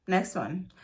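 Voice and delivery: usual speaking voice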